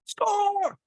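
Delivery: a sudden very high mock cry of the word; hammed-up parent yelling; imitating a yell. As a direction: cry it out like an upset parent in the stands. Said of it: "you're gonna wanna yell the most".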